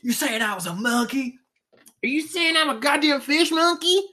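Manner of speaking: high, loud indignant yokel. Retold or imitated. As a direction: play an offended creationist, shouting. Mocking an outraged creationist.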